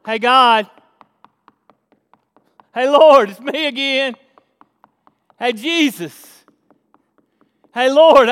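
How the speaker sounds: insistent calling out, pausing between each call; persistent praying. Acted. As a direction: pester someone with repeated, punched calls. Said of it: "a persistent type of praying".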